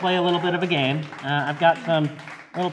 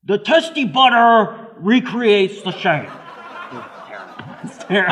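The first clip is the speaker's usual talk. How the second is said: Donald Trump; 6 semitones higher, 6 dB louder than usual. Acted. Host doing the game card as a Trump impression. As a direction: drag it out like a Trump rally line. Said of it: "That was Donald Trump".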